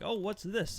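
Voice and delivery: high-pitched mock Italian surprise; stupid Italian accent. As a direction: perk right up in a silly Italian accent. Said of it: "talking in my stupid Italian accent".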